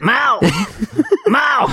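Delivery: high mock "Mao!" cries with laughter; deer hunter yell. To a slapper, a high mock Deer Hunter yell. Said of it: "channeled the deer hunter".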